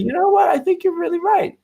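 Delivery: lightly voiced sweet grandma giving in; sweet old lady. Old lady agreeing to the upgrade, sweetly won over. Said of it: "sweet southern voice".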